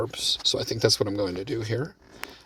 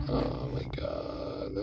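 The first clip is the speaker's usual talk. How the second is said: a slow, low, breathy "Oh my God"; soft whispered astonishment at the table; breathy disbelief; 9 dB quieter, much slower than usual. Real, not acted. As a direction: let it fall out of you in disbelief. Watching the table keep chopping.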